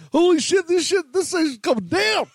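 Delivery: high-pitched, excited holler; high, amazed "can you believe this"; excited retold exclamation. Retelling astonished reaction to bartender.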